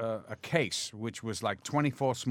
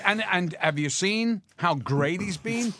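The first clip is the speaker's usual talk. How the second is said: high, loud, laughing defence; excited; 8 semitones higher, 5 dB louder than usual. Retold or imitated. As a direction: gleefully justify it.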